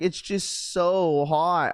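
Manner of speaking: a light imitation of a small girl, slow and drawn out; whiny little daughter. Dad lightly imitating his daughter complaining about the heat, drawn-out whine.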